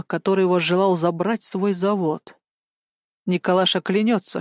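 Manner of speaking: ordinary delivery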